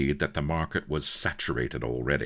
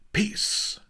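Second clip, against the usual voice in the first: sharp, whispered snap; sharp pirate queen. A narrator's reading.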